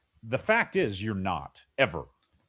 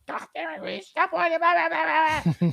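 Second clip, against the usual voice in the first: put-on high, loud exasperated yell at a child; mock screaming parent; 4 dB louder than usual. Parent mocking their own yell at a whining child, high and loud. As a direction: blow up like a parent who has lost it. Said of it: "screaming and yelling and saying".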